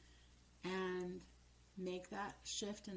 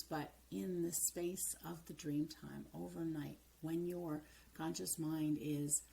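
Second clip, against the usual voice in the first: normal talking voice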